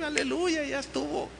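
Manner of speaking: high, hushed mock cheer; gleeful. Preacher imagining a happy man, high mock glee. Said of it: "así muy contento".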